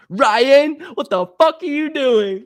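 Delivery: high, loud mock mom voice; imitating mom. Son imitating his mom catching him, high mock shout. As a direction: squeal it like his angry mom on the phone. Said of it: "It's my mom, bro".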